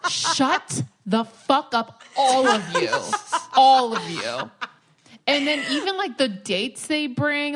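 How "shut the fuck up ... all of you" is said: mock angry snap. Retelling snapping at rowdy guests.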